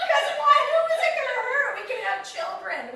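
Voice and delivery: high, very loud laughing shout; mock outraged shout. Comic retelling her reply to the driver, mock outraged shout. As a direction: shout the questions in comic disbelief. Acted outburst at cabbie's claim, laughing.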